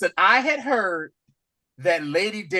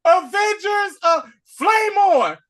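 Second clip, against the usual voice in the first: mock hero shout; 6 dB louder, slower than usual. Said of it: "he was like".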